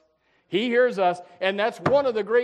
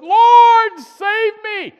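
high, loud cry of a sinking man; desperate cry; 13 semitones higher, 14 dB louder, much slower than usual. Retold or imitated. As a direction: cry out as the waves take you. Retold Peter sinking in water.

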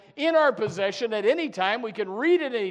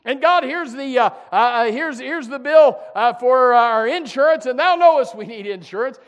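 louder, earnest mock prayer; a worried believer praying over bills; 4 semitones higher, 7 dB louder than usual. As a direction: list each bill to God with urgency. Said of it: "and you say".

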